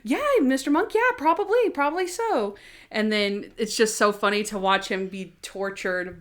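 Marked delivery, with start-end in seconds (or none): 0.0-2.6 high, louder coaxing voice; mock sweet voices. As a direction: humor him gently, pitched up. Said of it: "they're like".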